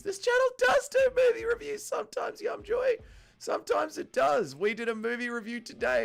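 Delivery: very high, put-on squeaky answer; silly high voice. Streamer answering a viewer's question in a silly high voice. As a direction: answer in a goofy falsetto.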